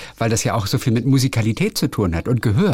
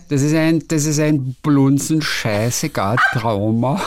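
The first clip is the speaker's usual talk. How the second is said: drawn-out, slow Viennese; Viennese accent; 3 semitones higher, slower than usual. Shown as a demonstration. Showing the co-host how Viennese sounds, drawn-out Viennese accent. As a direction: lay the Viennese dialect on slowly. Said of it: "kann man das, äh, wienerisch sagen".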